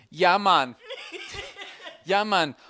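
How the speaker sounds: hammed-up Jamaican accent; bad Jamaican accent. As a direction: badly imitate a Jamaican accent. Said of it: "this really bad Jamaican accent".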